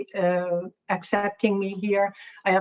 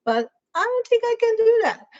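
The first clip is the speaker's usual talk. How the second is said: very high-pitched mock protest; imitated helpless little objection; acted squeaky doubt; 4 dB louder, faster than usual. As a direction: squeak it out, far above your usual pitch. Acted doubtful reply to a critic.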